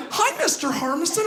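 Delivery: put-on high chirpy kid greeting; cheeky little boy. Robbie greeting the girl's dad, chirpy kid voice. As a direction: act out an innocent kid. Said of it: "be like".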